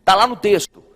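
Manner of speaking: loud, emphatic reading of scripture; forceful preaching. Real, not acted. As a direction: press each phrase out loudly to the church. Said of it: "preaching at full force". (pregação enfática e alta)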